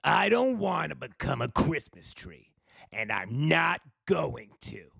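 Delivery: a grumpy little tree, sulky and stubborn; grumbling tree. A narrator's reading. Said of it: "The little tree grumbled".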